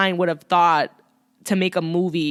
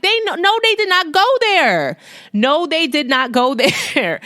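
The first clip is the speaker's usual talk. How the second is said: outraged disbelief; 8 semitones higher than usual. Retold or imitated. Viewer scandalized by the movie's Trump hats, outraged disbelief. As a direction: gasp it out like they crossed a line. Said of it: "I was like".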